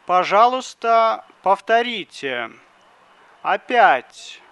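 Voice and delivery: ordinary talk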